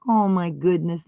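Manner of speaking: usual speaking voice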